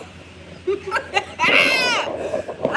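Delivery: a burst of high real laughter. Real, not acted. Cracking up at her own broom joke, high laugh.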